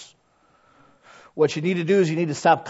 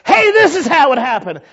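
higher and louder, bursting to share good news; excited news; 9 semitones higher, 7 dB louder than usual. Acted. Preacher acting out calling a church friend, excited. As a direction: ring a friend all lit up.

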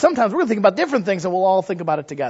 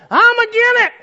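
much higher, louder contrary put-on voice; Brother I'm Agin It; 7 dB louder than usual. Church naysayer rejecting every plan, high and loud. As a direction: object to everything in a high stubborn squawk. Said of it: "called Brother I'm Agin It".

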